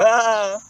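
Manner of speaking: loud mocking ah. Mocking exclamation.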